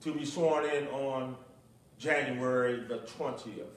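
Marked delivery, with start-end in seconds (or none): none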